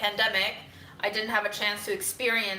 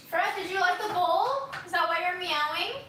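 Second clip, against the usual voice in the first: high, sing-song pet talk; cat talking voice; 9 semitones higher than usual. Real, not acted. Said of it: "Do you have a talking to the cat voice?".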